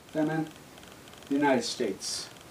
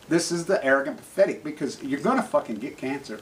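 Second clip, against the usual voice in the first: normal talking voice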